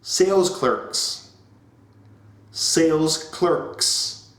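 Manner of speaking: Sales clerks said very slowly, stress on sales; slow drilled enunciation. Shown as a demonstration. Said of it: "emphasize and enunciate".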